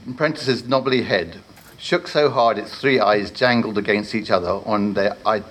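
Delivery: usual speaking voice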